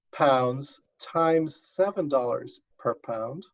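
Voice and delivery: normal talking voice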